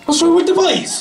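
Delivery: high, puzzled quoted question; imitating Dylan. Dylan turning to ask about the shouting lads, mock puzzled. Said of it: "Dylan turns to me and says".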